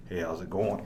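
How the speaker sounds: low, soft, nonchalant imitation; understated, quiet deadpan hello; casual greeting. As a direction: play an unbothered guy greeting from the dark. Imitating man lurking in cell.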